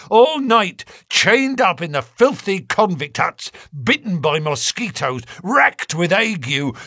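rising furious outburst; impassioned. A narrator's reading. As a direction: pour out the grievance, building. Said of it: "came out in a wild whirl of words".

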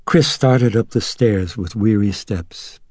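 ordinary delivery, nothing marked

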